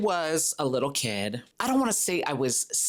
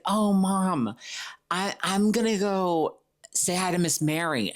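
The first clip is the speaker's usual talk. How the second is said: higher voice, a hint of pleading kid; lightly voiced child; 6 semitones higher than usual. Kid telling mom about visiting neighbor, lightly childlike and higher. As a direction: lightly play a kid wheedling.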